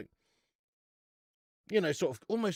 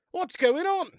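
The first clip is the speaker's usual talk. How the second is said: much higher, baffled at his own stomach; honestly puzzled at the bloat; high, incredulous question; bewildered; 13 semitones higher, 5 dB louder than usual. Real, not acted. Stomach feels full.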